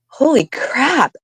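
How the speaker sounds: high, slow drawn-out disbelief; slow astonished gasp of words; shocked. Retold or imitated. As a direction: let it stretch out in shock. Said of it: "I was just like".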